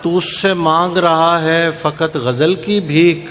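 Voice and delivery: slow, measured mushaira recitation; poetry recitation. Acted. Poet reciting ghazal to a mushaira audience, measured declamation. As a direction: recite the couplets, drawing out key words.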